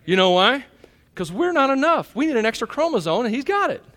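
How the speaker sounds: excited preaching. Real, not acted. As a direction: proclaim it with delight. Excited about his son.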